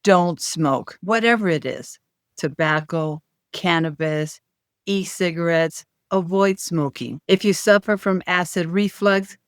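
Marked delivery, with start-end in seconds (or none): none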